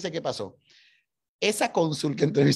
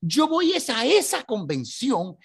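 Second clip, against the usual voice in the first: much higher, incredulous then firm; indignant surprise; 8 semitones higher, 3 dB louder than usual. Retold or imitated. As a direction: scoff at the idea, then insist. Isaac answers the consul.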